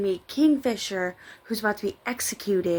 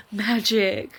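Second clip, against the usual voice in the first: mocking. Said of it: "mocking her".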